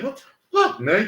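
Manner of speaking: high, incredulous cry; shocked outrage. Retold or imitated. The narrator at his own party, high and incredulous. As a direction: pitch it way up in disbelief. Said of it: "your boy was like".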